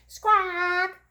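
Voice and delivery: high squawk. Acted. Saxophone noise.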